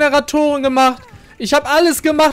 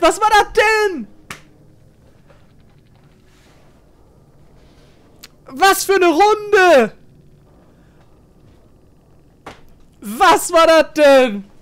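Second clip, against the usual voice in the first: amazed disbelief; 4 semitones higher, slower than usual. Real, not acted. Gamer in disbelief after win.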